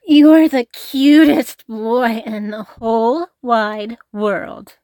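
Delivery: loud, adoring gush; gushing praise. Acted.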